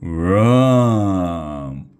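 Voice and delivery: long drawn-out loud call. A narrator's reading. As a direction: cry out for mommy, stretching each word. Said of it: "called the green dragon".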